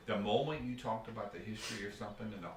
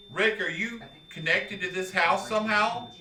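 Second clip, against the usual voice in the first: loud, raised call to a spirit; 9 semitones higher, 7 dB louder than usual. Real, not acted. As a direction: call out loudly to the house. Calling out to a spirit.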